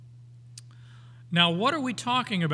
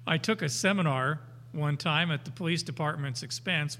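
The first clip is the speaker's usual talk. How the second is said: normal talking voice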